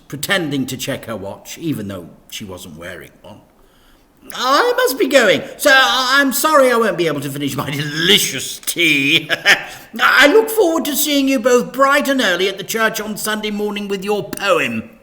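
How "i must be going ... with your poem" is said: high, bright-pitched vicar, louder; bubbly church lady listing options; cheery vicar. A narrator's reading. Vicar listing church performances to Dad, high and cheery.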